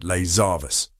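plain reading voice

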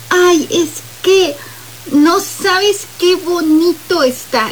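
high, slow, gushing fake praise; feigned delight. Shown as a demonstration. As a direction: gush over it without meaning a word. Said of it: "también me cambia el gesto de la voz". (elogio fingido, agudo y lento)

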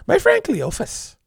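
high, slow coy woman's voice. Acted. Woman naming a dangerous friend to a dude, coy. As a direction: drop the name slowly and sweetly.

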